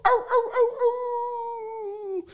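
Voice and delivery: high howling yelps; wolf howls. Acted. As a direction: howl like a wolf. Werewolf howls.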